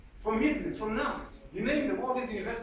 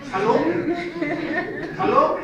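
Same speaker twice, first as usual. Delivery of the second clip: high, loud "Hello?" to the crowd; a loud, high "Hello?" to the audience; loud call-out; 7 semitones higher, 8 dB louder than usual. Real, not acted. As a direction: shout it out to wake the room up. Calling out to the audience.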